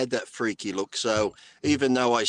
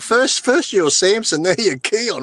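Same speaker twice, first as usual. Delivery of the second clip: high, loud, hammed-up bewilderment; mock exasperation; 7 semitones higher, 8 dB louder than usual. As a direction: complain loudly as if hopelessly confused. Mock exasperation at co-host's shifting roles.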